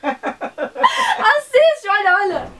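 very high, loud, laughing; high laughing shout. Real, not acted. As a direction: squeal at her to watch. Insisting grandma watches.

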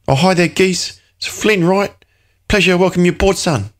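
put-on the assessor John greets the new trader in a hearty Cockney accent. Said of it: "John's Cockney accent".

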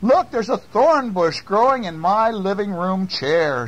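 put-on high-pitched old-lady voice; a fretting old lady pointing out trouble; high storybook old woman; upset old lady. A narrator's reading.

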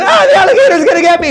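mock terror while running to hide; fake scared. Jose pretends to flee the alligator, high mock panic. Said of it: "he went".

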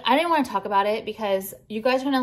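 ordinary talk

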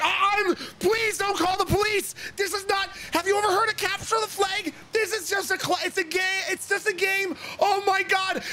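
high, frantic pleading; afraid, panicked. Acted. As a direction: beg not to be reported, in rising panic.

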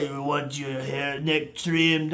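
low, slow, soft Russian mobster; growing menace in a slow, quiet Russian accent; attempted Russian accent. Russian barber offering a neck trim, attempted Russian accent. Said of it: "I can't do a Russian accent".

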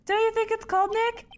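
high cheery small-talk voice; chipper character voice. Acted. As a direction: chirp a cheerful greeting. Same character voice.

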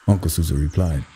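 ordinary delivery

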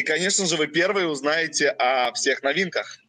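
ordinary talk

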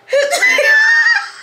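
piercing squeal of laughter; high-pitched shriek laugh. Acted. Showing what she saw across the gym, squealing laugh. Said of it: "All I see is this".

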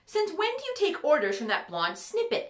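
high-pitched indignant question; indignant demand. A narrator's reading. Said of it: "Ember demanded".

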